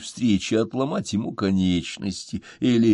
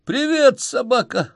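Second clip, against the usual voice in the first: joyful shout; 11 semitones higher, slower than usual. A narrator's reading. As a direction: call out with delight on the phone. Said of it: "обрадованно кричу я".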